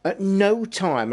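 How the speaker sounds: slow, NO drawn out high; slow emphatic At no time, NO high; stress on NO. Real, not acted. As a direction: lean hard on NO. Emphatic point about never doing it.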